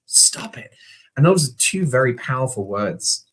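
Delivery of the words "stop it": breathy, voiceless stop it; whispered stop it. Retold or imitated. Doctor cuts off the patient's story, soft whisper. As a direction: hush it out under the breath. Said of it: "he just".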